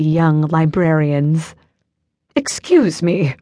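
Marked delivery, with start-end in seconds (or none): 2.3-3.3 put-on slow, haughty British accent; drawn-out upper-class British; British snob looking down on a librarian. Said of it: "with an accent I'd last heard when Prince William visited".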